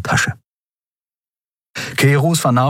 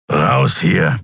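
growled; 3 semitones lower than usual. A narrator's reading. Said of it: "knurrte Kero".

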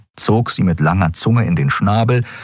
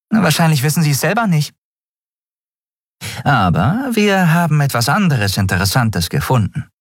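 put-on high street-kid girl voice; lively young girl, matter-of-fact; 10 semitones higher than usual. A narrator's reading.

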